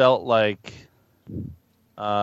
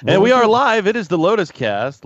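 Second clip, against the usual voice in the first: big show-opener announcement, louder and higher; radio announcer; 8 semitones higher, 5 dB louder than usual. Acted. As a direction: announce the show like going on air. Opening a show on voicemail.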